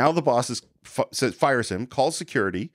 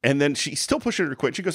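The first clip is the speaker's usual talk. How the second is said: ordinary talk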